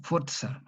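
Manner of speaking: "forza" with a sharp ts, fortsa; "forza" the Italian way, ts. Shown as a demonstration. Teacher showing Italian "forza" with ts. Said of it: "una sorda en italiano".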